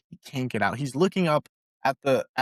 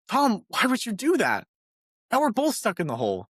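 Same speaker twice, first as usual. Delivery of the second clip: higher, mock incredulous question; exasperated disbelief; 8 semitones higher than usual. Stuck man asks Tom why he jumped in, mock exasperation. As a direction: play the disbelief a little big. Man scolds buddy in hole.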